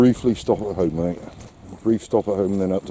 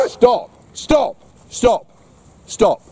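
yelling stop; 14 semitones higher, much slower than usual. Real, not acted. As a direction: shout the dog down, pitch way up. Dog won't stop, owner yells.

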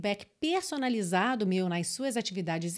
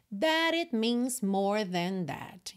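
slow demo reading; much slower than usual. Shown as a demonstration. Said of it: "that it, rarara".

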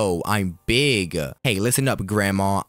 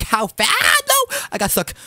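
high-pitched wail of alarm; hammed-up panic at being caught; panicked scream; 3 dB louder than usual. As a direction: cry out as the escape fails. Gets stuck during the chase.

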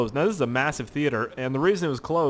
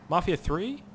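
ordinary delivery

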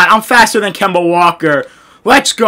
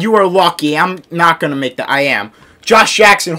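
ordinary delivery

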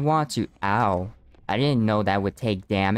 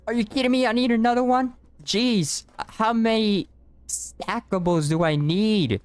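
exasperated; 11 semitones higher than usual. Real, not acted. Exasperated at needing more blocks.

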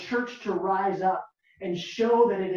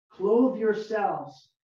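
very slow and deliberate; read slowly; much slower than usual. Shown as a demonstration. Pastor reading the verse aloud to the church, very slow. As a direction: stretch each word out. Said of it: "I'm gonna read it slowly".

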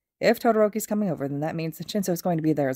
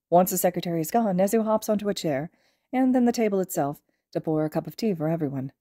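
ordinary delivery

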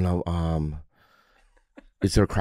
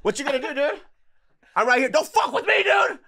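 mock tough shout. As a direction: shout the threat in a squeaky fake tough voice.

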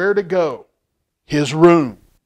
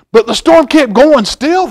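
rising excitement; 9 semitones higher, 8 dB louder than usual. A narrator's reading. Storm intensifies in story.

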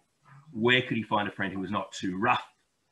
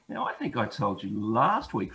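plain reading voice

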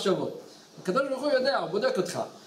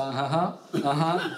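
wife mid-prayer; 4 semitones lower, much slower than usual. Retold or imitated. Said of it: "אשתו באמצע לקרוא תהילים".

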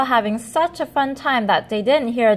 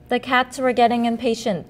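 plain reading voice

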